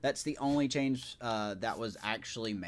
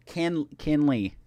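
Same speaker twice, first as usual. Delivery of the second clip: put-on southern accent; 6 dB louder, slower than usual. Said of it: "Excuse my southern accent".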